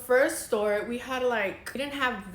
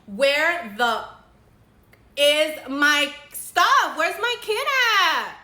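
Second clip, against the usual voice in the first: high, loud, drawn-out outraged scream; dramatic scream; 5 semitones higher, 9 dB louder, slower than usual. Retold or imitated. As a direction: scream it as a demand, stretching the words. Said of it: "I screamed".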